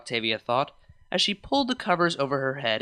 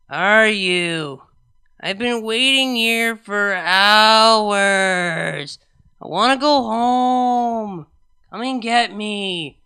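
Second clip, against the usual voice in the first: put-on high, loud, slow drunken whine; drunk whining; 5 semitones higher, 8 dB louder, much slower than usual. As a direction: plead to be picked up, slurring. Drunk whiny voicemail.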